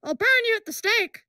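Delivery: high, hammed-up scolding old lady; mock a shrill church biddy; old busybody voice. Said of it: "like the old bitty".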